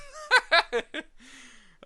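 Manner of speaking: big far laugh bursting out; loud burst of laughter. Real, not acted. Laughing at his own flirty joke, big burst of laughter.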